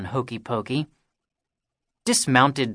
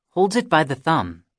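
plain reading voice

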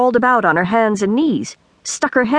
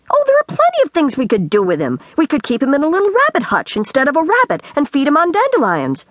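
higher-pitched, lightly voiced eager child; lively storybook girl, eager; 8 semitones higher than usual. A narrator's reading. Girl explains what to do with the old man, lightly eager. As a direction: breezily pitch a silly plan in character.

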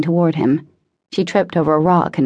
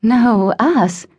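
higher, drawn-out numb echo; dumbly repeated; 6 semitones higher than usual. A narrator's reading. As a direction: repeat it as if stunned. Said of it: "she repeated dumbly".

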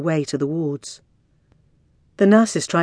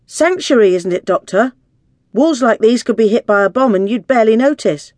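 loud, higher-pitched insistent argument; clamouring women; 5 semitones higher, 7 dB louder than usual. A narrator's reading. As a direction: pipe up forcefully from a crowd. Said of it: "The women all spoke up at once".

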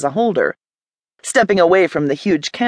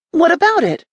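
defensive woman snapping at the visitor; snapped; 11 semitones higher than usual. Acted. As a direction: bite the words back at him. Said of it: "she snapped".

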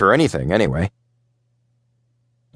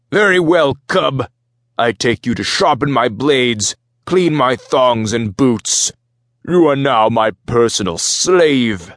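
forceful, raised captain's voice, drawn out at the end; commanding Minotaur captain; 4 semitones higher, slower than usual. Acted. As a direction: lay down orders to the cub. Voices the captain taking the boy.

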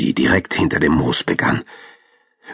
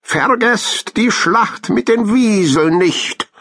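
high, drawn-out squirrel call; voice from high above; slower than usual. A narrator's reading. Squirrel reminds hamsters from up the tree, high calling voice. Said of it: "eine Stimme von hoch oben".

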